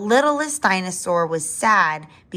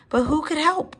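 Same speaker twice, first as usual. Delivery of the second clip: high, rising puzzled question; wondering; 9 semitones higher than usual. A narrator's reading. Littlest dinosaur unsure whom to fetch, wondering. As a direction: ask it high, at a loss. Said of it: "wondered the littlest dinosaur".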